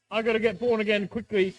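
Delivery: ordinary talk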